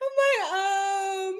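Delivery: high, squealing talk through laughter; real helpless laughter. Real, not acted. Streamer cracking up at a viewer's claim, laughing helplessly. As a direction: crack up and squeeze the words out.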